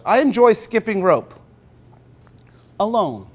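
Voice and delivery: slow, measured picture-book reading; reading to children. Acted. Teacher reading the book aloud slowly to the class. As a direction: read it slowly to five-year-olds.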